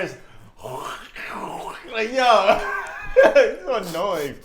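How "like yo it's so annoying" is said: giggling through the words; laughing while complaining. Real, not acted. Laughing at crunching noise.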